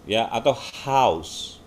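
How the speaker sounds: "house" very slowly, gliding au. Shown as a demonstration. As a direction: stretch the au in "house". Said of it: "Itu bunyi au".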